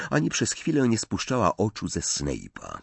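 plain reading voice

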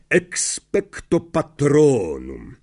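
teacher slowly sounds out the spell; slow spell demo. Shown as a demonstration. Lupin demonstrates the spell for Harry, slow and deliberate. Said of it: "oto zaklęcie”, Lapin odchrząknął".

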